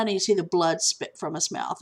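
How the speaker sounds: usual speaking voice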